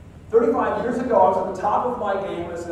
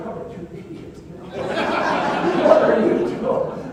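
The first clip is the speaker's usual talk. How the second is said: whispered scolding of himself through laughter; slowed, breathy self-scolding with a laugh; nervous self-mocking laugh. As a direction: laugh at your own foolishness under the breath. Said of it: "It's like".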